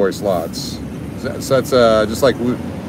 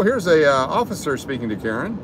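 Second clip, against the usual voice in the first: normal talking voice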